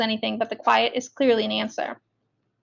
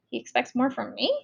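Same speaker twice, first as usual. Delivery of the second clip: ordinary delivery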